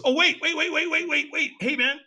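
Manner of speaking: rapid high "wait, wait"; urgent sudden recall. Retold or imitated. Buddy suddenly remembers.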